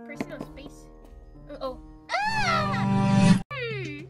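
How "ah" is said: high loud sudden shriek; startled scream. Acted. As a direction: shriek in alarm.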